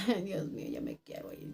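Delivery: hushed, voiceless retold plea; whispered thought. Worker telling herself she wants to leave, whispered. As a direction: whisper it to yourself.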